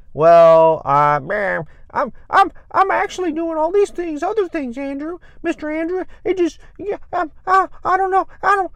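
high, squeaky imitation of a client making excuses; mock whiny client stammering out excuses. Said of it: "That's what I hear".

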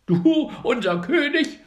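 high, loud angry cry of the flock; angry birds shouting. A narrator's reading. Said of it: "schrien die Vögel zornig".

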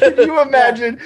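high, real laughter in the words; laughing. Real, not acted. Co-host picturing the gory helmet scene, laughing. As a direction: crack up while asking.

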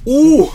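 sharp high whoop of heat; high loud whoop. Real, not acted. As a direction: yelp at the spice. Spice hitting hard.

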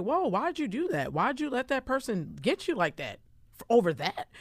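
high-pitched incredulous questioning; mock disbelief. Voices disbelief at someone losing it.

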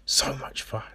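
fading whisper. Real, not acted. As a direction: let it die away in a breath. Echoes in whisper.

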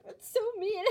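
mock outrage, high and loud; playfully over-the-top sarcastic protest. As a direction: exaggerate the scolding, voice jumping up. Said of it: "I'm being sarcastic".

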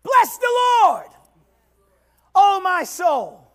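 high, loud praise cry; preaching shout. Acted. Preacher crying praise to the congregation, loud and high. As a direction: lift it up loud.

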